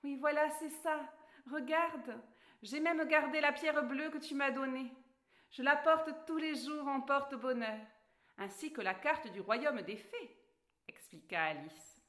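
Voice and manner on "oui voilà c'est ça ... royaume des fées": put-on higher, bright girl character voice; Alice's voice. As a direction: play the polite young girl Alice, pitched up. Alice shows the stone.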